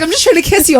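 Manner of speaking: high whiny mock boyfriend. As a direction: whine like a rejected boyfriend. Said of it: "He's like".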